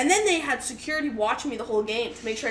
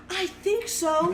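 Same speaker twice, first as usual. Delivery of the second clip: slow, higher, hesitant imitation of a mom; feigned uncertainty; 5 semitones higher, slower than usual. Mom to Mounties asking if this is her kid, feigned doubt. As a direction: draw it out as if unsure.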